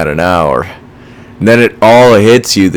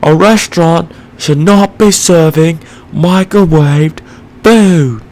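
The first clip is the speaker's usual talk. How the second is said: high, slow mock chef lecture; Gordon Ramsay impression; 9 semitones higher, slower than usual. Vlogger mimicking a TV chef scolding a restaurant owner, slow and high. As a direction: lay down the law like a celebrity chef. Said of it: "He's like".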